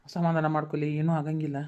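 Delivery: low, soft and gentle; calm her down tenderly; hushed comforting words to a crying girl; gentle reassurance. Acted. Uncle calms crying niece.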